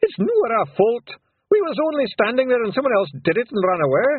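high, loud Scots shout. A narrator's reading. Rob Anybody denying his name to the lawyers, loud shout. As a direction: bluster the lawyers away.

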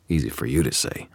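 ordinary delivery, nothing marked

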